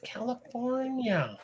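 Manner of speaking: put-on drawn-out kid quote. Reminding the co-host of the movie kids, slow and soft. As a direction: draw it out dreamily like the movie kid. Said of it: "Remember the, the kids?".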